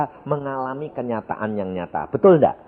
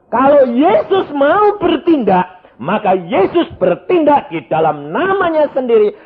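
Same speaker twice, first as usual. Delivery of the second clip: full-force preaching; 11 semitones higher, 9 dB louder than usual. Acted. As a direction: preach it out over the congregation. Preacher proclaims Jesus acts.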